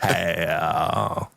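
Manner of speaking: put-on low, drawn-out Western Valley Girl greeting. Trying Sarah's accent, low Western Valley Girl. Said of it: "She's kinda sounding like Brad".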